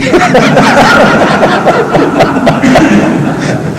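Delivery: high airy laughter; breathless laugh. Real, not acted. Amused by the doctor's quip, airy laugh. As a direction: laugh freely at the joke. Laughing at his own joke.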